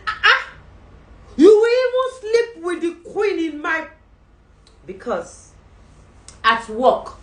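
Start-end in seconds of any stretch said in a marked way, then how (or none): 0.1-3.9 high, loud laughing outrage; the king catches Haman, loud and high; outraged king. Acted. King finds Haman at the queen, loud outrage.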